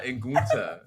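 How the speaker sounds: "Gunther" with the "tuh" hit hard; "Gunther", second syllable emphasized; stress on tuh. Shown as a demonstration. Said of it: "I'm putting the emphasis on, on the "tuh".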